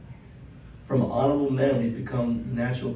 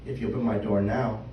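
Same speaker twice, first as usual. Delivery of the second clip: ordinary talk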